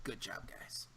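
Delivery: breathy whispered praise; whispering. Real, not acted. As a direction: whisper it off-mic. Whispered aside.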